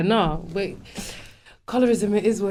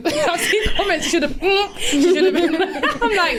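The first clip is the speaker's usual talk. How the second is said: laughing; 8 semitones higher, much slower than usual. Real, not acted. Laughing at silly online comments.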